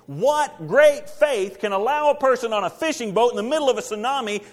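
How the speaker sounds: loud, high, driving storm sermon; preaching at full force. Acted. Preacher to congregation, loud and rising.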